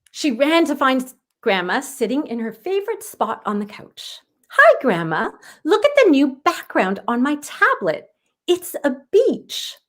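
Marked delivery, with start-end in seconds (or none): 4.5-9.7 put-on high, proud child showing off; a girl announcing with delight, slowing at the end; Aubrey announcing. Girl showing Grandma her tablet, high and proud. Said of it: "Aubrey announced".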